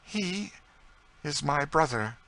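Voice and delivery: high-pitched, halting emotion; understated tearful confession in a high voice; bursting into tears. A narrator's reading. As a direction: break it up with feeling. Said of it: "burst into tears".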